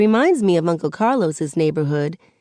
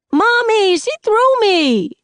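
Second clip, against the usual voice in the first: put-on high, louder little kid whine; small boy whining to his mother; 6 dB louder, slower than usual. A narrator's reading. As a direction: tattle to mom like a wronged little boy. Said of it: "he whines".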